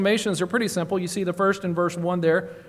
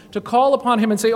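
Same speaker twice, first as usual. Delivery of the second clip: ordinary delivery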